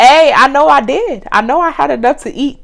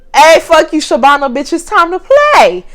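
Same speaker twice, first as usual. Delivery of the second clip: loud, high defiant retort; mock defiance; 6 semitones higher, 4 dB louder than usual. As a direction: taunt her, hammed up. Her retort to Shabana, retold.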